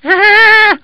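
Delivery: very high, loud bleat of a mournful lamb. Acted. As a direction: bleat it out sadly. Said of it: "bleated the lamb in a mournful tone of voice".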